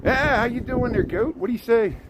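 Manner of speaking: talking to the goats. Pretend. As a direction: chat up the goats in a high, goofy voice. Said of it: "See, I'm talking with the animals".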